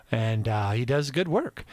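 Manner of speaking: ordinary talk, nothing marked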